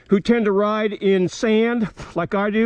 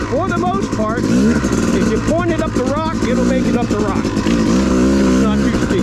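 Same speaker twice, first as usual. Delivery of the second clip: over engine noise; 4 dB louder than usual. Real, not acted. Talking while riding the dirt bike.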